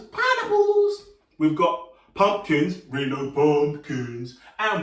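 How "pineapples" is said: very high, slow and stretched; high silly fruit voice for kids; squeaky high pineapple voice. Shown as a demonstration. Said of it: "We've got pineapples".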